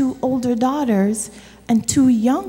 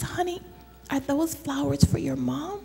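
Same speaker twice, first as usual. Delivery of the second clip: gentle shopkeeper; 2 semitones higher, 9 dB quieter than usual. Acted. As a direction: coax the little girl kindly. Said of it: "she says".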